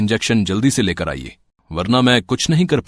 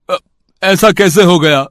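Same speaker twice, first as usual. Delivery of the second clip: shocked; 6 semitones higher, 5 dB louder than usual. Acted. Father hears son has cancer.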